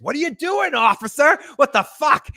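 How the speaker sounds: high, loud mock confrontation; acted outrage. Host acting out [name] confronting the cop, outraged high yell. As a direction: yell it in someone's face, high-pitched.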